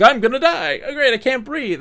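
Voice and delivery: very high, loud, hammed-up panic; comic imitation of choking to death; mock suffocating panic. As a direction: squeal like someone running out of air, played for laughs. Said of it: "and he's suffocating".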